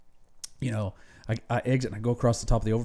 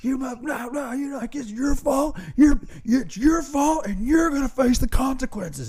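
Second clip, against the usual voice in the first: much higher mock tirade, the end slowed down; imitating angry Brett; 14 semitones higher than usual. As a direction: splutter the cussing high, then drag out the threat. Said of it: "He's just like".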